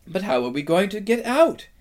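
high, quick questioning voice; anxious faun. A narrator's reading. Tumnus asks the crowd about locked gates, anxious question. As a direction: ask it high and hurried, rising at the end.

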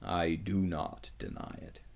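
low, soft, solemn admission; subdued, weighty lion voice; grave lion. A narrator's reading. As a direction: admit it quietly, voice sunk. Aslan gravely concedes the point.

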